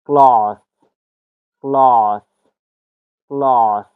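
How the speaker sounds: "کلاس" mit kurzem amerikanischem A; American accent. Shown as a demonstration. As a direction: say "کلاس" with a flat short A. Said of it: "در امریکن ما خواندیم".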